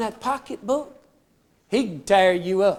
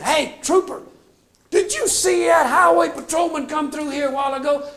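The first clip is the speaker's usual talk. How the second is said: excited shout; 7 semitones higher than usual. Retold or imitated. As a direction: yell it running up, all worked up. Excited witness runs up to trooper.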